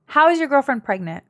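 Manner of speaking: stunned question to a viewer; shocked disbelief. Real, not acted. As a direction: ask it as if you can't believe it.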